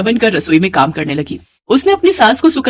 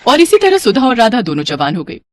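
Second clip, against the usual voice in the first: ordinary delivery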